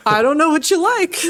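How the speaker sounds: exasperated app. Acted. Plays YouTube at a loss.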